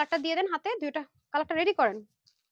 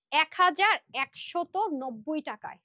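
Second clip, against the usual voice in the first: measured, emphatic pacing; slow price announcement; much slower than usual. Real, not acted. Seller revealing today's price to everyone, slow and emphatic. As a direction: spell out the price slowly, word by word.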